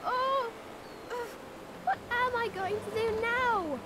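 alarmed, rising panic; frightened cries. Acted. Girl adrift in a boat, panicking aloud.

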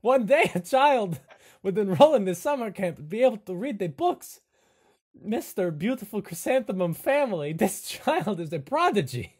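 loud, awestruck proclamation in character; hammed-up astonishment, breaking into laughs; Igor. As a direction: proclaim it like a prophecy. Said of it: "and Igor says".

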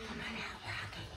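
soft hushed whisper. Real, not acted. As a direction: whisper to coax the raccoon. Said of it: "Everybody just whisper".

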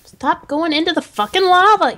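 angry. Real, not acted. Yelling at the game.

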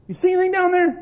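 loud high prodding question. Retold or imitated. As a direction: call down to the kid. Dad questioning son.

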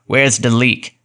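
lightly voiced brusque workman; a hint of gruff gasman with a "de" for "the"; snorting gasman. A narrator's reading. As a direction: snort it like a tradesman. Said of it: "he snorted, after the manner of gasmen".

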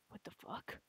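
hushed under-breath curse; stunned whisper. Acted. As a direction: breathe it in shock.

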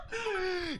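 high squealing laugh; laughing shout. Real, not acted. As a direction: burst out laughing and shriek. Laughing outburst at the result.

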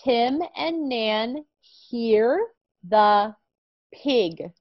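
very slow, clear reading; slow reading aloud. Shown as a demonstration. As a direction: model the sentence word by word. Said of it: "read with [name]".

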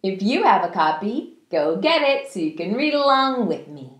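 ordinary delivery

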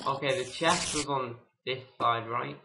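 normal talking voice

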